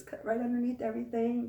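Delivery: usual speaking voice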